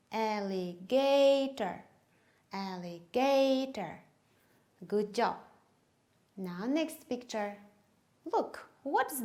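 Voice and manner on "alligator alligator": slow alligator with GA held long; stress on GA. Shown as a demonstration. Teacher showing fingers with the class, stress on GA. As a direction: lengthen and lift GA in alligator. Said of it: "let's do it together".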